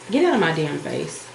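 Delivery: usual speaking voice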